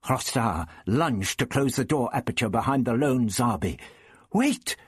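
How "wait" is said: high-pitched urgent yell; high, narrated yell of alarm. A narrator's reading. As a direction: cry out to stop them. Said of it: "Barbara yelled".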